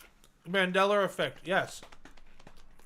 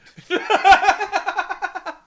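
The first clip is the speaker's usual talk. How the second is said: shrieking laugh; high loud cackle; 12 semitones higher, 12 dB louder than usual. Real, not acted. Bursts out laughing.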